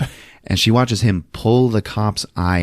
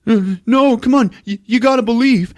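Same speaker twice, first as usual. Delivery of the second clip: high, mock-pleading voice; earnest pleading; 12 semitones higher than usual. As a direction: beg them to keep the faith, pitched up. [name] pleads with fed-up Jennifer.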